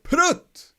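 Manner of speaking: sharp high yelp of prut; exclamation. Shown as a demonstration. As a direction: spit out prut like a sudden curse. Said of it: "The exclamation would be like".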